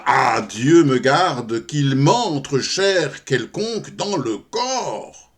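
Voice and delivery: loud, drawn-out theatrical plea; grand stage lament in verse; horrified pious refusal. Acted. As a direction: declaim it slowly, appealing to God. Prostitute invokes God, refusing meat.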